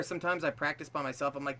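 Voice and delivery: normal talking voice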